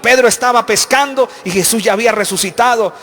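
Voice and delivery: loud sermon cry; fervent preaching. Acted. Preacher telling the shore reunion to the church, loud and fervent. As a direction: preach it with rising fire.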